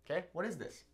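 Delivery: normal talking voice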